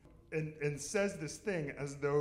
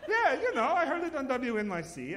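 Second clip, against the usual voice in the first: put-on high, loud laughing old lady; high loud insistent granny voice; mother-in-law voice; 10 semitones higher, 7 dB louder than usual. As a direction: push it up high through laughter.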